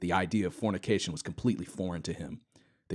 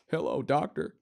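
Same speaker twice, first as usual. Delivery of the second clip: put-on smug Southern accent; 7 semitones higher than usual. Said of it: "in his smug Southern accent".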